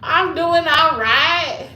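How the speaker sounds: high, loud, stretched-out cheer; exuberant holler. Pretend. Boasting to viewers about her accents, exuberant holler.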